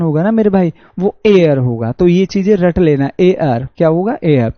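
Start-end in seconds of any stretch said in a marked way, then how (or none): none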